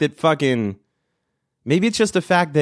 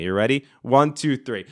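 ordinary talk, nothing marked

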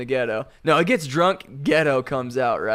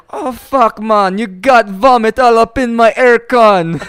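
loud, high mock Haitian accent, outraged; loud put-on Haitian accent, fed up; 8 semitones higher, 10 dB louder than usual. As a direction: yell it as the Haitian driver, accent hammed up. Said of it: "I can't do a Haitian accent".

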